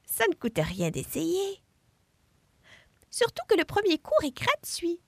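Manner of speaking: high, light birdlike voice; little bird voice. Acted. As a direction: chirp it like a small bird. Bird character voice.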